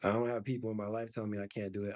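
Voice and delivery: ordinary talk, nothing marked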